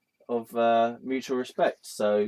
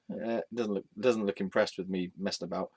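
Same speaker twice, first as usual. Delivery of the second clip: usual speaking voice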